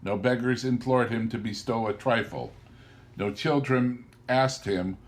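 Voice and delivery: plain reading voice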